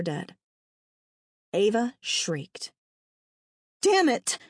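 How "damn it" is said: sharp, higher-pitched curse; furious outburst, pitch jumping up; angry bark. A narrator's reading. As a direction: bark it out in anger. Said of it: "Lexi barked in anger".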